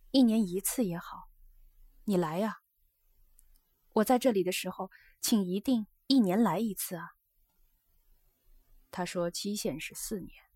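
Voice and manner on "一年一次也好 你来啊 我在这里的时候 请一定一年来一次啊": earnest, accommodating plea; understanding, reasonable. A narrator's reading. Geisha asks her guest to visit yearly, reasonable and earnest. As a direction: show understanding while urging him. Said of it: "显出一副通情达理的样子说".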